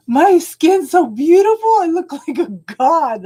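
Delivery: a hint of a preening young man; grandson's proud boast. Grandma mimicking grandson bragging about his clear skin, lightly.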